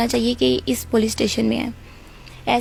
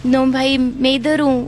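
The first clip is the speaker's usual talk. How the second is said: retold call-out. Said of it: "زور سے آواز لگائی".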